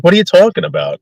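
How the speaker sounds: high, baffled "What are you talking about?"; host to guests, lighter disbelief; milder incredulous. Retold or imitated. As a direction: repeat it a little calmer. Repeat to scared guests.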